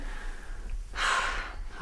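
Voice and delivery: heavy breathy sigh; long exhale of reluctance; reluctant sigh. Acted. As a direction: sigh as you give in. Reluctant to step outside.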